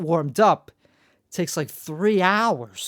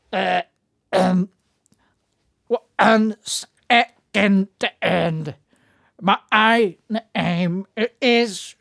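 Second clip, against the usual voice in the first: very slow, loud broken syllables, cutting out like a bad radio; RFK; 3 semitones higher, 4 dB louder, much slower than usual. Mocking RFK trying to introduce himself, voice breaking up. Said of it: "without breaking off and some of it getting".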